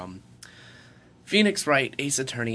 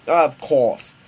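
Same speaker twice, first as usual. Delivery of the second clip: loud, higher British "of course"; bad British accent; 5 semitones higher, 12 dB louder than usual. Scientist agrees with his son, bad British accent. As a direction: redo it in hammy British. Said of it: "I'm doing a British accent".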